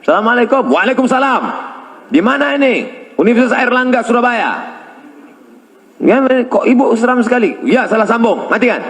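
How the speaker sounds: high, quick, sing-song phone chat; phone conversation voices. Retold or imitated. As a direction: act out both sides of a call. Acted phone call with wrong number.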